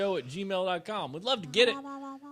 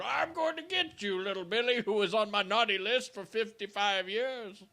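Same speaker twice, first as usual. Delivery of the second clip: stalk little Billy as undead Santa; zombie Santa; 7 semitones higher than usual. Pretend. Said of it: "like zombie Santa going".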